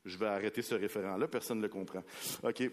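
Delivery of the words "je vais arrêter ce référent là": normal talking voice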